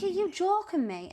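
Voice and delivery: high-pitched incredulous question; retold disbelief. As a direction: act out being unable to believe it. Her disbelief at the news.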